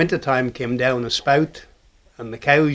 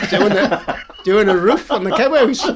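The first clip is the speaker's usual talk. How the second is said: laughing through the words, higher and louder; 6 semitones higher, 6 dB louder than usual. Real, not acted. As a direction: chuckle it out as you tell the punchline. Laughing while telling funny story.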